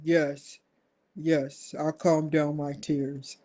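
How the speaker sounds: low, soft, meekly obedient reply; meek old man. Retold or imitated. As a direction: answer like a docile old man. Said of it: "Biden's like".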